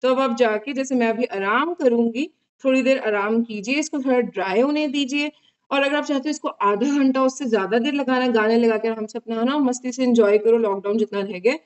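mask stiff around mouth; talking with mask on; wearing a face mask. Real, not acted. Seen 0: Tissue face mask covers face and upper lip; lips stay visible.

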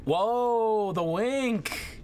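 surprised. Real, not acted. Reacting to a game moment.

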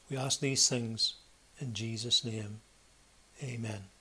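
solemn, reverent praying voice; earnest pleading prayer. Acted. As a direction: pray earnestly for the congregation. Closing of the prayer.

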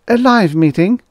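high, loud, shocked question; gasped. A narrator's reading. Assistant reacts to the producer's order, gasping. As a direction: gasp it in disbelief, pitched far up. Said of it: "Frick gasped".

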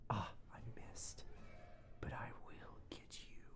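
hushed whisper, mock-menacing; playful whispered vow; whispered threat. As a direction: whisper a promise of revenge. Whispering threat to game enemy.

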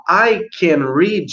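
"I can read" drawn out slowly as the mistaken form; slow wrong-form example. Shown as a demonstration. Teacher warns pupils against the wrong form, said slowly. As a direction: slowly model what not to say. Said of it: "Porque se você falar".

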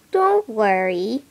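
ordinary delivery

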